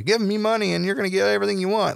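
high Southern televangelist voice; Southern accent. Acted. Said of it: "why there's a Southern accent when that happens".

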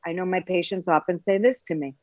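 normal talking voice

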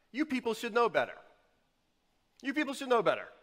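high, loud reproof in quick bursts; scolding. Retold or imitated. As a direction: chide them loudly, pitched well up. Voices Jesus scolding his listeners.

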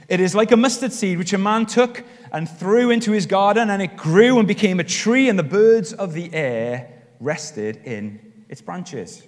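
loud, high, proclaimed verse, slowing at the end; scripture reading. Acted. Preacher reads Luke's parable to the congregation, loud proclaimed scripture reading. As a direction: proclaim it boldly, then slow right down to finish.

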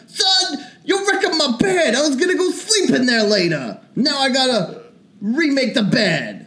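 high, excited little-man voice; Kevin Hart impression. Friend does his Kevin Hart voice, scolding son, high and excited. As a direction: pitch it way up as a tiny agitated dad. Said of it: "That's Kevin Hart".

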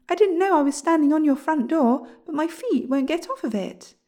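loud, high apologetic call. A narrator's reading. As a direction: call it down through the ground. William calls down to dwarf.

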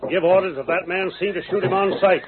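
high, pressing commands; urgent orders. Acted. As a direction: hurry them to their posts. Boss issues urgent orders.

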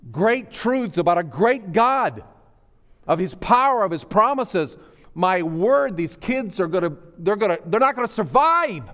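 high, loud pulpit cry with stretched key words; impassioned preaching. Real, not acted. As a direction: preach it at full force, pleading urgently with the congregation. Preaches at full force.

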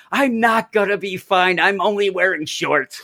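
high, complaining quote; lightly whining Deadpool; mock Deadpool whine. Deadpool stuck in hell, a mock complaint. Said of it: "he's like".

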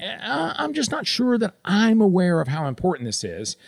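self-deprecating. Acted. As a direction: tentatively play down what you know. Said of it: "even a little self-depreciating".

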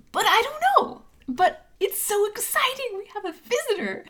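very high, giddy gnome voice; excited gnome. Acted. Gnome thrilled to have a visitor, high and excited. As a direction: let the thrill lift the voice right up.

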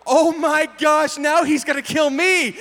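high, loud, drawn-out alarm; mock panic. Driver as the deer pops up, mock panic. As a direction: cry out as if under attack.

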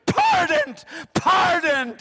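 very high, loud ringing cry of pardoned; triumphant shout. Acted. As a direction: proclaim it like a verdict from heaven. Jesus's word shouted in climax.

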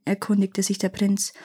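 usual speaking voice

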